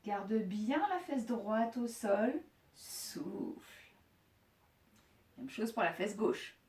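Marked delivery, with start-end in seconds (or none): 2.7-3.9 very soft, voiceless exhale on the word; breathed-out cue. Shown as a demonstration. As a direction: breathe the word out slowly and softly. (soufflé tout bas, en expirant)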